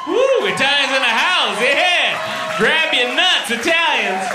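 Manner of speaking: loud, high hype yell; hyped fan shout. Pretend. Hyped Italian fan cheering.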